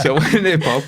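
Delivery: laughing at his own story as he tells it. Real, not acted. [name] retelling meeting his old teacher, laughing.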